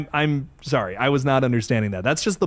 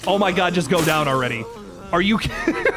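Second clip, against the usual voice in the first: usual speaking voice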